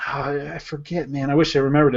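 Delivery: ordinary talk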